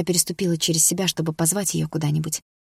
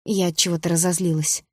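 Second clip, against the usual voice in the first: usual speaking voice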